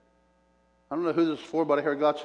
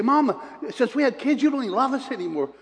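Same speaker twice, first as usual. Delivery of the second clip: higher, quick complaining daughters; whiny grown daughters; 9 semitones higher than usual. Retold or imitated. Sisters complain to their mother, in a higher, quick mock whine. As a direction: sisters whining to Mama about being neglected, mock hurt whine. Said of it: "My sisters would get so mad".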